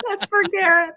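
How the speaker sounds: laughing through words; giggling while speaking. Real, not acted. Laughing at screen mishap.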